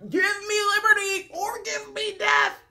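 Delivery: high, hammed-up declamation; mock oratory. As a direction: proclaim it like a grand mock speech. Mocks speech against donut offer.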